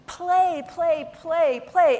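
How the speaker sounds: each "play" punched high and loud, falling; emphatic repeated play. Real, not acted. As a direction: hammer the word "play" again and again. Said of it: "repeating play emphatically".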